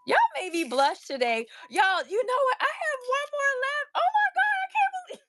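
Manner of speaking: high, loud squealing thanks; loud excitement. Real, not acted. Thrilled seller squealing thanks to her live viewers. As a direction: squeal with real delight at the sales. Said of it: "Y'all know I'm loud".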